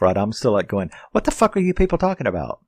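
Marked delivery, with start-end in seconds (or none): 1.1-2.6 high, rapid-fire indignation; pissed off. Retold or imitated. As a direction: blurt it fast and high, retelling your outrage. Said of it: "pissed off".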